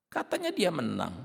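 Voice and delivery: sneering retold taunt; mild scornful quote; mocking. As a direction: jeer at the claim. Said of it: "Nyakitin cara ngomongnya itu".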